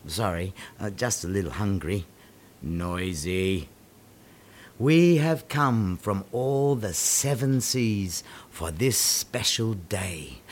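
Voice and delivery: ordinary talk, nothing marked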